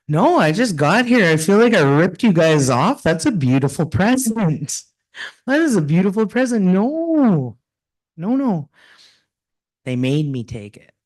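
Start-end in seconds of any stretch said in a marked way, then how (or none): 0.1-8.7 high, flustered refusal of a gift; retold embarrassed protest. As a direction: protest it's too much, pitch up.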